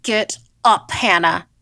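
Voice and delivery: loud, sharp order to get up; urgent yell. A narrator's reading. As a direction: yell at your little sister to move. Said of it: "I yelled".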